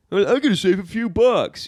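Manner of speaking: high mocking imitation of neighbor. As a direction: mimic a cheap, clueless neighbor.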